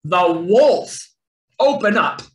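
loud, gruff wolf demand; loud storybook wolf threat. A narrator's reading. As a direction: bellow it at the door like a wolf. Wolf threatens at brick house.

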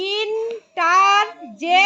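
very slow, ইন-টার-জেক-শন in separate beats; syllable by syllable. Shown as a demonstration. Teacher giving a four-syllable example, syllable by syllable. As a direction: stretch out each part of interjection. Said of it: "tetra syllable বলে দিচ্ছি".

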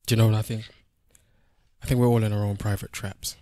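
dramatic monologue. Acted. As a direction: deliver it like a lightly acted film monologue. Recites dramatic film-like monologue.

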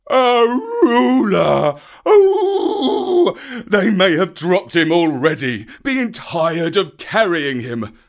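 high, loud, despairing wail; wailing bear. A narrator's reading. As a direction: wail in misery for the lost cub.

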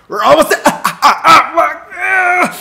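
high, loud yelps mid-sentence; real pain yelling. Real, not acted. Player shocked near max intensity, yelling in pain. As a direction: break off into cursing screams.

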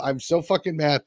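usual speaking voice

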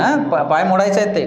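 ordinary delivery

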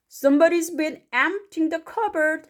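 high, slow character voice; indignant story father. Acted. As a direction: read as an astonished storybook dad.

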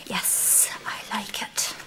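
breathy private whisper, no voice; whispered aside. Acted. Visitor whispers her delight about the house. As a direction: murmur it under your breath as a secret.

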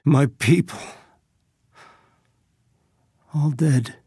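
shocked grief, long pause between phrases; stricken. Acted. Leader finding the team dead, stricken. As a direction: take in the loss, stricken. Finds own people dead.

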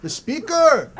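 high shout across the store; a guy three aisles over, shouting about a speaker; calling out. Real, not acted. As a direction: call it aisles away.

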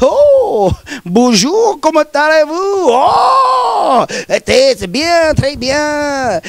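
hammed-up French accent with a big high "Oh"; mock French. Hamming up a French greeting in a mock French accent. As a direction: gush like an over-the-top French tourist.

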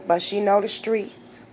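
ordinary talk, nothing marked

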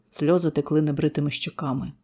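ordinary delivery

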